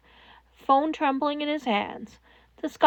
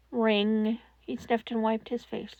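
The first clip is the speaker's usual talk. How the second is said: usual speaking voice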